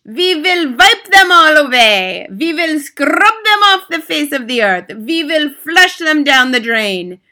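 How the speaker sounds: high, loud ranting scream. Acted. Grand High Witch screaming her plan to her audience. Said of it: "screamed the Grand High Witch".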